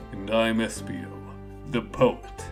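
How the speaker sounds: low, understated introduction; Espio the poet. Acted. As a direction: drop low and composed.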